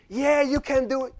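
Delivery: mock crowd cheer. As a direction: shout encouragement like the crowd.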